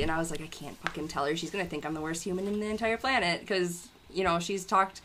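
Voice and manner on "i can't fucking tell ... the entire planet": panicky hushed refusal. Retold or imitated. Her panicked reply to husband.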